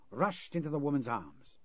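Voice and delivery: usual speaking voice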